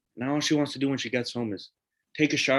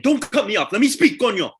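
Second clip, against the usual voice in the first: angry outburst; 9 semitones higher, 6 dB louder than usual. Acted. Father demands to be heard.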